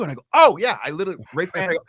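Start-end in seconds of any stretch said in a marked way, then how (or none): 0.3-0.8 high, loud burst of recognition; sudden realization. Retold or imitated. Teen remembering the long snap, loud high exclaim. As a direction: it just clicked, blurt it out.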